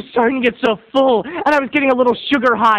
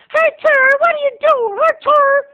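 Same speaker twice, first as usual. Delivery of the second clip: put-on much higher, piping little boy call; shrill-pitched child calling out; high kid voice; 3 dB louder than usual. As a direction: squeak it out like a small boy yelling for friends. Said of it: "Cousin James's voice was still high".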